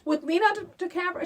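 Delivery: high, loud, fast disbelief; retold high-pitched astonishment; incredulous questions. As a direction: pile on the questions in amazement. Retelling her own shocked questions.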